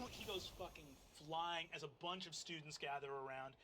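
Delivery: ordinary talk, nothing marked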